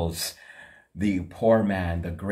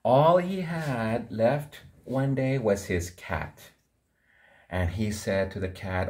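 ordinary delivery

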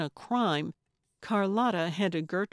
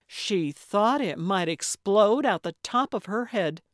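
ordinary delivery

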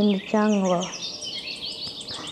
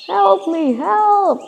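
louder, higher shout for help; loud cry for help; 5 semitones higher, 5 dB louder, much slower than usual. Acted. Trapped lion calling out for help, loud roaring cry. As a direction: yell it out from the trap. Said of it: "and he roared loudly".

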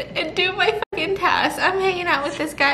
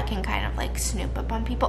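whispering; 5 semitones lower, 7 dB quieter than usual. Real, not acted. Sneaking in game, whispering.

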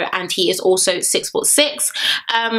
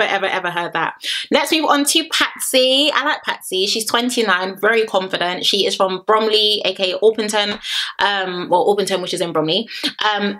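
ordinary talk, nothing marked

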